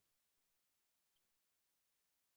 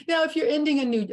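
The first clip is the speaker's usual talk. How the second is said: laughing as you start, higher and quick; amused, louder, hurried restart; 6 semitones higher, 5 dB louder, faster than usual. Real, not acted. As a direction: break into a laugh while talking. Laughs as she resumes talking.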